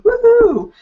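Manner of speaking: high, bright whoop; excited cheer. Real, not acted. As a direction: cheer for the prize. Cheering giveaway.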